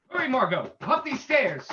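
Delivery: urgent call. Acted. Hero urges companion up stairs.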